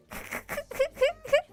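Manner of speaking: high-pitched giggling; high giggle. Real, not acted. High laughter.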